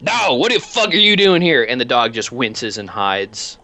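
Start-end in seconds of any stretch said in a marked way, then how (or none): 0.0-1.6 high, raised-voice scolding; angry homeowner. Acted. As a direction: scold the dog as the angry man of the house. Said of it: "he looks down and he goes".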